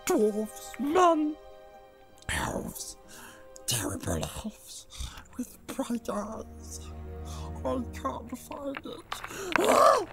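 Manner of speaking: high creature voice, broken with sobs and hushed whimpers; sobbing in the throat. Acted. Gollum sobbing to himself about his tormentor. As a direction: weep to himself, not to them. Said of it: "he sobbed in his throat".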